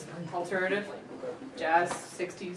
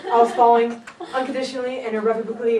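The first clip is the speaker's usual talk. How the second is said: louder, giggling through the words; laughing through line; 3 semitones higher, 8 dB louder, faster than usual. Real, not acted. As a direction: keep laughing while reading.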